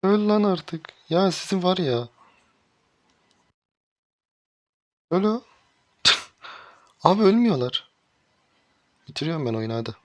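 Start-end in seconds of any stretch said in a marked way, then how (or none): 0.0-7.9 high-pitched exasperated calls at the screen; urging the enemy to die, voice jumping up; frustrated yelps. Real, not acted. Gamer urging enemies to die, high exasperated yelps.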